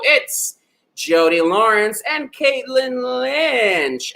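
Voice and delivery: high, slow, drawn-out winner announcement; announcer reveal. Real, not acted. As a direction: stretch the winners' names out grandly.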